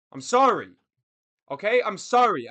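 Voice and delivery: loud, unapologetic sorry; defiant apology. Real, not acted. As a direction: say sorry but not mean it.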